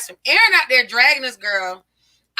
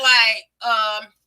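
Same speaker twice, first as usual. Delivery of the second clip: ordinary delivery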